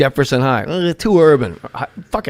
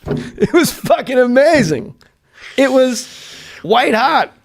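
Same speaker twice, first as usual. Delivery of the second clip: laughing; 8 semitones higher, slower than usual. Real, not acted. Laughing recalling the bit.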